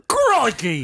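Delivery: high, hammed-up Australian exclamation; bad Australian accent. Friends mocking Australians, bad Australian accent. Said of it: "worst Australian accent ever".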